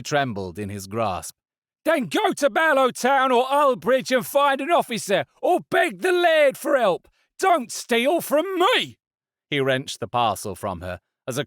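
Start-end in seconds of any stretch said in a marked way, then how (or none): 1.8-9.0 angry stallholder. A narrator's reading. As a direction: spit out each word. Stallholder shouts at woman.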